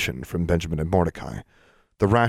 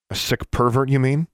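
bitter retort; 7 semitones higher than usual. A narrator's reading. Alexandra throws his slur back.

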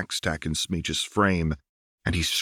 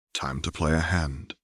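ordinary delivery

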